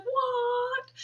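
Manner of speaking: drawn-out, very high mock-shocked "what"; flabbergasted. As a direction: imitate her utter disbelief, stretched and sky-high. Acting out woman's disbelief at age.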